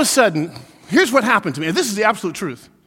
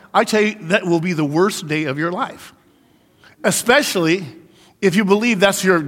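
usual speaking voice

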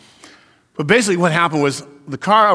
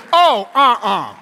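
dismissive no; 4 semitones higher, 5 dB louder, much slower than usual. Pretend. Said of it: "It speaks to the situation and says".

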